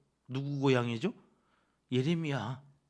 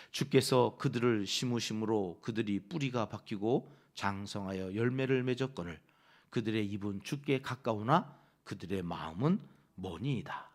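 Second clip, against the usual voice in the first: low, steady reading aloud of scripture; 5 semitones lower than usual. Acted. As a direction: read the verse together, measured.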